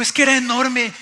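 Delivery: loud, high insistence; bursting with excitement. Retold or imitated. Husband insisting to his wife on what he saw, excited. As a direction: insist on it with amazed force. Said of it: "no cabía de la emoción".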